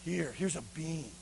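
low, subdued offering; grudging boy. Acted. Beggar boy giving the governor a bean, grudging. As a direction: hand it over softly and reluctantly.

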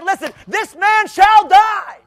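slow furious shout; mad David. Retold or imitated. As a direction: pronounce the sentence in a rage. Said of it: "he was so mad".

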